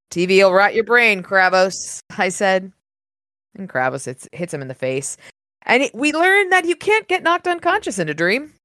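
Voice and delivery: low, even audiobook reading; story narration. A narrator's reading. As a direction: read the passage like a novel narrator. Reads the novel aloud.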